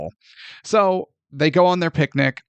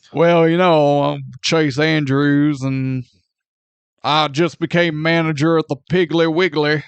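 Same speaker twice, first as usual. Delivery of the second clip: bad Southern accent. Host mocking Chase Andrews' introduction, hammed-up Southern accent. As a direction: imitate a badly faked Southern accent. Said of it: "that god-awful Southern accent".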